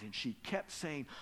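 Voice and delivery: ordinary talk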